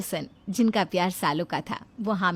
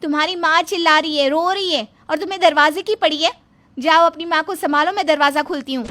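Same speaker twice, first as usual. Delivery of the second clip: higher and louder, scolding hard; angry scolding; 6 semitones higher, 6 dB louder than usual. Acted. Wife scolding her husband over his crying mother, loud and sharp. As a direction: snap at him to go to his mother.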